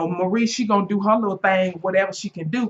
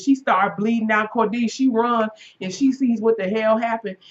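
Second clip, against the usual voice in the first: usual speaking voice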